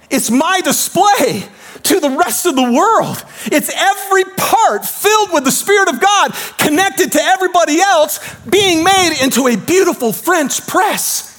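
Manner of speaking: Jesus building up passionately. Retold or imitated. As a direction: preach it as Jesus with growing fire. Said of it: "Jesus is up there going".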